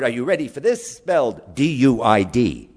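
ordinary talk, nothing marked

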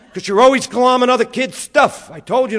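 loud, high scolding; scolding mother. Retold or imitated. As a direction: snap back like an exasperated mom. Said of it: "My mother used to go".

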